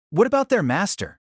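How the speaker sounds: plain reading voice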